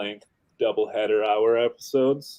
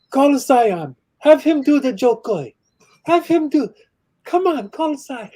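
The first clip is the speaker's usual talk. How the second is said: much higher, louder mock voice of eager relatives; imitating the family; 7 dB louder than usual. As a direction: pitch it way up like excited relatives on the phone.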